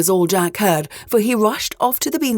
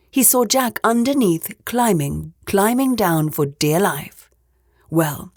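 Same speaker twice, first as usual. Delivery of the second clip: ordinary delivery, nothing marked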